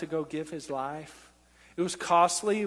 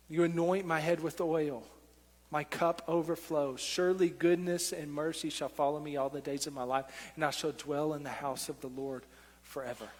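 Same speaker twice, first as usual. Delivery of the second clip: lower, softer psalm recitation; reflective scripture recitation; 4 semitones lower, 5 dB quieter than usual. Acted. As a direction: recite it quietly, as if to oneself. Quotes a psalm to himself.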